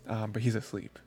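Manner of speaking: ordinary delivery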